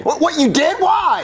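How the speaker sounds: high-pitched disbelief; startled, high questioning; shocked. Acted. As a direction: burst out in shock. Shocked at the confession.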